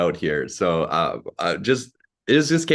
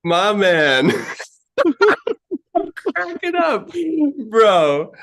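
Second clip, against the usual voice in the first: much higher, loud laughing hype; excited cheer; 5 dB louder, much slower than usual. Real, not acted. Cheering after emotional question.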